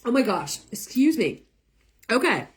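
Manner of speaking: recovering from choking; choking on saliva. Real, not acted. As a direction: clear the throat between words. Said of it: "Choking on my saliva".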